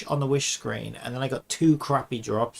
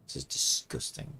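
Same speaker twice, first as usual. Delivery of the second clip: whispered disgust; 11 semitones lower, 8 dB quieter than usual. Real, not acted. Reacting with disgust.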